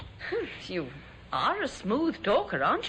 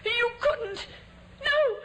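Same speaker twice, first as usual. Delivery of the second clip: rising panic, pitch climbing high; a terrified old lady begs him to stop; frightened pleading; 12 semitones higher than usual. Acted. Widow facing the hammer, rising panic.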